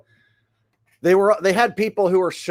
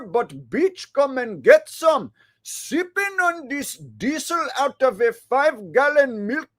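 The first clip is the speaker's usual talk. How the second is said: mock Indian accent; 7 semitones higher than usual. Streamer reads a requested hillbilly rap for chat, in a hammed-up Indian accent. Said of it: "read 'em in, uh, an Indian accent".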